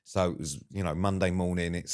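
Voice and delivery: usual speaking voice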